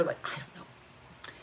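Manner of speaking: half-whispered to herself; whispered aside. Real, not acted. Talking to camera, she drops into a whisper.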